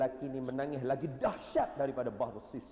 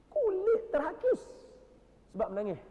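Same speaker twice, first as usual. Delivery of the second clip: emphatic exclamation; 6 dB louder than usual. Real, not acted. Preacher marvelling to the congregation at eroded skin. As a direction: cry it out in awe at the tears.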